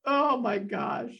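high, squealing through laughter; giggly, creeped-out delight; helpless laughter. Real, not acted. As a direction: gush while cracking up. Laughing over creepy smile.

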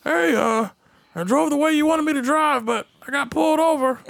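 put-on sheepish old driver, high voice; high-pitched comic excuse; Bash. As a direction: confess like a hesitant old man. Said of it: "he always get pulled over".

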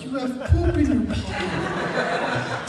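high, drawn-out words through laughter; half sing it slowly, cracking up; giggling retort. Retold or imitated. Teller can't look at his friend, giggling.

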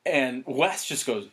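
normal talking voice

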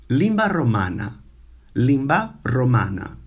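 limba romana, stress on limba; Romanian limba romana. Shown as a demonstration. Teacher models limba romana for students. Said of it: "the Romanian language is".

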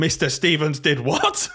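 put-on slow, high, laughing disbelief as a kid; incredulous kid. Teacher imitating a pupil going home, laughing in mock disbelief. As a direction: play the shocked child, dragging it out. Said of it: "they're like".

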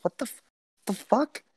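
high baffled what the fuck. Real, not acted. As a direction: squeak out disbelief. Outraged at show gimmick.

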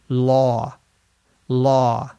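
law twice with the open aw of all; model word. Shown as a demonstration. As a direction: repeat law like all and call. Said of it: "The vowel in the word law".

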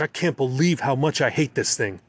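ordinary delivery, nothing marked

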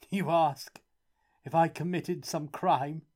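a joyless laughing question; hollow laugh. A narrator's reading. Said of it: "with a hollow laugh".

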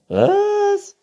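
very high, loud „Was?“; Waschbär. A narrator's reading. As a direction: squeal it as the raccoon. Raccoon figure, shrill question. (hoher, lauter Waschbär-Schrei)

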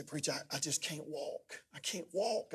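softly voiced, ailing man; imitating a sick friend's feeble voice; weak, sick man. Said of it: "he was so sick".

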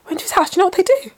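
high, laughing disbelief while retelling it; horrified friend. Retold or imitated. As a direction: imitate her shocked account with laughter. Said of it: "She goes".

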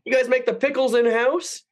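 high, wondering customer; mock-innocent high question. Mimicking customer in kitchen.

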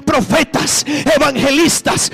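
loud, rising to a high climax; preaching at full force. Acted. Preacher to church about apostles, building loud climax. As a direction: build the list to a slow peak.

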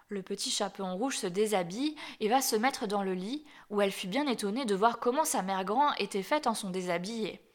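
ordinary delivery, nothing marked

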